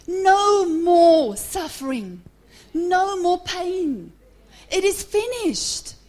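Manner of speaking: slow, loud, high proclamation; full-force victory cry from the pulpit; victorious scream. Acted. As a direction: shout it out in triumph, stretching the words. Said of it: "in his victory, he screamed".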